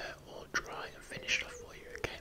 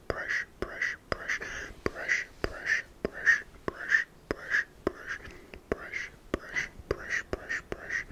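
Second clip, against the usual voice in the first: whispered brushing sounds; 5 dB louder, much slower than usual. Acted. Mimicking brushing the hair.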